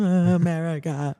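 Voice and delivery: slow, deflated mock-tired greeting; mock exhausted nurse at shift's end; worn-out shaky. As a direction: droop it out like you're drained. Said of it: "Later on, I'm shaking".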